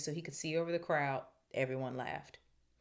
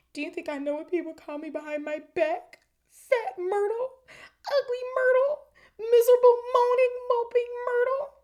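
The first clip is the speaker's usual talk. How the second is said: very high wail, building louder; upset Myrtle; 11 dB louder than usual. A narrator's reading. Tearful Myrtle reciting insults to Hermione, high upset wail.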